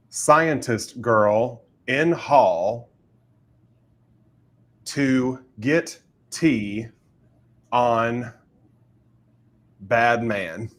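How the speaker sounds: slow, halting, word by word; halting one-syllable words. Shown as a demonstration. Writer describing her scene for the chat game, halting. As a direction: pause between each word as if picking it. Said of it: "with only one syllable words".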